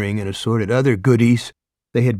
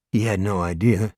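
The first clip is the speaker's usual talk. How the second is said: usual speaking voice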